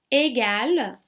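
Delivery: normal talking voice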